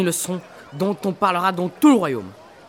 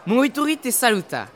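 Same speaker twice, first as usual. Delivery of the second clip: usual speaking voice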